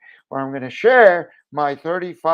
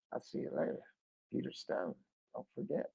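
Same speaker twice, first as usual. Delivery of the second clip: quiet sign-off; 7 semitones lower, 19 dB quieter, faster than usual. Real, not acted. Signing off the video.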